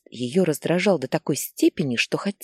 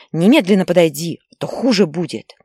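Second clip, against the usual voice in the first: much louder threat; threatening shout; 2 semitones higher, 7 dB louder than usual. Acted. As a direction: yell the warning after him. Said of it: "кричала Лариса". (мать громко угрожает убегающему сыну)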